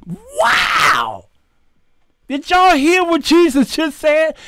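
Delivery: high, astonished wow to the crowd; mock amazement. Preacher to congregation, mock amazed. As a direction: act stunned at his words.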